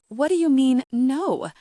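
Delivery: loud, outraged high question; indignant. Acted. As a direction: protest loudly and high. Suze outraged.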